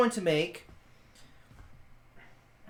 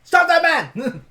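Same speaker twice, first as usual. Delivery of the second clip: high, loud shout; calling out; 12 semitones higher, 9 dB louder than usual. Acted. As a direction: shout it out to halt someone. Calling out in game.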